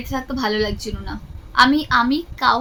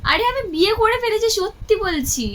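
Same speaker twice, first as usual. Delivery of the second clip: pitched up, pressing the claim; insistent; 9 semitones higher than usual. Pretend. Insisting to disbelieving friend.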